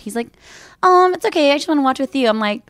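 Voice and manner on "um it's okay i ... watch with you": put-on young son voice. Voicing her young son.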